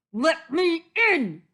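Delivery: loud, high wolf howl of a demand; upset wolf yelling at a door. A narrator's reading. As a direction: howl to be let in. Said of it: "he howled".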